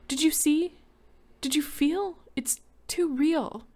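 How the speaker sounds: high, loud tearful questions, slowing at the end. A narrator's reading. Crying wife pleading with her husband, high and tearful. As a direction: ask him through tears. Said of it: "cried steadily".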